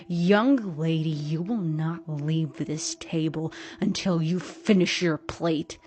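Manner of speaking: loud, slow, stern command; yelled order. A narrator's reading. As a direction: lay down the law loudly. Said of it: "and yelled".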